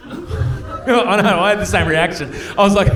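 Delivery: ordinary talk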